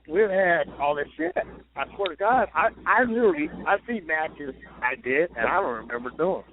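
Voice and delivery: normal talking voice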